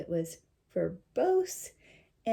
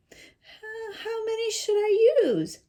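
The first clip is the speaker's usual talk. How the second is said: put-on little girl; 13 semitones higher, 7 dB louder, slower than usual. Girl asks timidly.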